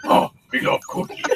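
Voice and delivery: throaty, unvoiced-sounding German monster growl; Cookie Monster. Asked to say it in that accent, Cookie Monster growl. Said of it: "in that accent, say".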